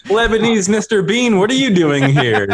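high, loud, laughing call-out; high mock-surprised voice. Mock quote in skit.

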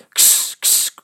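sharp hissed ks, then high urging; provocative, high-pitched incitement; egging on. A narrator's reading. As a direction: goad the boys into a fight. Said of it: "hitsten de jongens op met een prikkelend".

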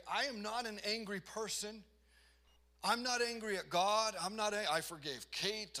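high, loud, protesting too much; insistent denial. Acted. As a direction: insist heatedly while denying any anger. Acting out loud denial of anger.

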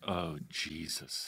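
low, slow, hushed dismay; imitated wince, very soft and drawn out; cringing reaction. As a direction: mutter it like an embarrassed relative. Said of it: "girlfriend and her family are like".

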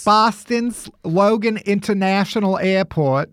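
put-on Kennedy-style Boston accent; a lightly done Boston accent. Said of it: "Every time I try a Boston accent".

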